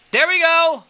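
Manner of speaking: high, loud triumphant cheer; excited cheer. Real, not acted. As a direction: celebrate the hit. Good hit in the game.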